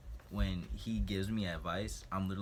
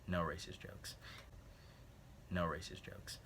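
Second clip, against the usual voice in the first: hushed warning to self; quiet whispered self-reminder; whispered aside; 9 dB quieter than usual. Real, not acted. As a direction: whisper it to yourself, voiceless. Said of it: "whispered reminder".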